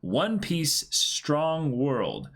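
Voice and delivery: bright, high chat-reading voice, pitched well up; upbeat message read. Acted. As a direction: read the donation out like a cheerful announcement.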